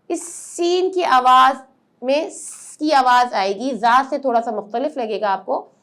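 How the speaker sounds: ordinary talk, nothing marked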